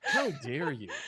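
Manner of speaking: slow, drawn-out mock outrage with a laugh; mock indignation. Friend joining in against the accent critics, slow laughing mock outrage. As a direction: stretch it out in hammed-up offence.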